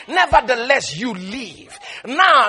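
ordinary delivery

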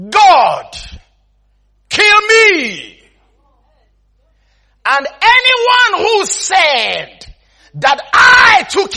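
loud, high, drawn-out vow; dramatic oath-swearing. Acted. Man swearing an oath before God, dramatic and loud. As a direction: swear it forcefully, stretching key words.

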